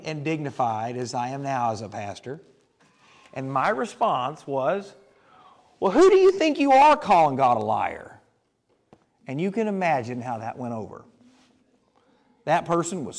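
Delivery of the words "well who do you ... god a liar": louder, higher indignant challenge; mock indignant retort. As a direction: snap back at the church member. Young pastor retorts angrily.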